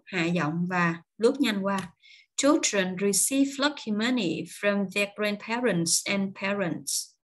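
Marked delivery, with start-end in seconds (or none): 2.4-7.1 slow, careful model reading in English; key words clear and drawn out, small words glided over; slow stressed reading. Shown as a demonstration. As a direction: read it slowly for learners. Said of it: "Chữ nào đậm thì mình sẽ đọc rõ, chậm và cao giọng".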